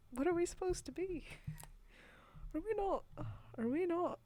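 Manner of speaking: high, small questioning voice; confused brain. Retold or imitated. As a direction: voice a puzzled brain, pitched well up. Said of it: "my brain is like".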